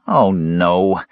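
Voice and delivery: drawn-out groan of dismay; dismayed, sinking moan. A narrator's reading. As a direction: groan in dread, sliding downward. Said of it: "Earwig groaned".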